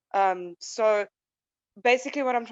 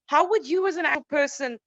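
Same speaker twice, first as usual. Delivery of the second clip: ordinary talk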